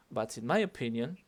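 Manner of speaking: MY weighted, opinion lilting up; stress on MY. Shown as a demonstration. Teacher echoing a student's good reading, stress on MY. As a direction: hit my, then singsong rise. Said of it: "acentuaste el my y hiciste el cantadito".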